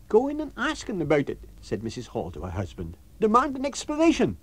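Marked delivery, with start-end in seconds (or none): 0.1-1.4 high landlady pushing her husband; Mrs Hall, indignant landlady. Acted. Landlady urging her husband to confront the lodger. 3.2-4.4 high landlady pushing her husband; Mrs Hall, indignant landlady. Acted. Landlady urging her husband to confront the lodger.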